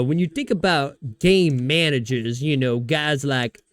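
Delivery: slow, drawn-out quote with the name stretched; mocking, deliberate imitation; Cam Newton. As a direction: stretch it out, leaning hard on the name. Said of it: "the way he says Brock Purdy".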